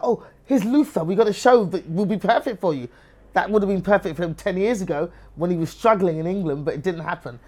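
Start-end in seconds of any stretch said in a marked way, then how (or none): 0.0-2.9 high, raised mock enthusiasm; gushing sales pitch, sent up; fawning TV bosses. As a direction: imitate executives suddenly eager to please. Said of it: "now they're like".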